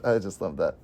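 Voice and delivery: usual speaking voice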